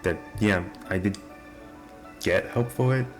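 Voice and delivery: normal talking voice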